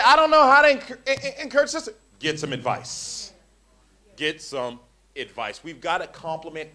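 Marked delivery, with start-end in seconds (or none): 0.0-1.9 whiny mock excuse. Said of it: "And you say".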